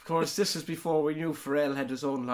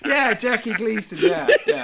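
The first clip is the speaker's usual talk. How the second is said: laughing through the words; giggling while teasing; 7 semitones higher, 7 dB louder than usual. Real, not acted.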